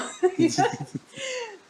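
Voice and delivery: high, bright burst of real laughter. Real, not acted. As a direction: let the laugh break out high. Laughs after the retort. (carcajada aguda)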